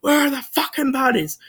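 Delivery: hammed-up high-pitched police yell; mock cop yell. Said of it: "they're all screaming".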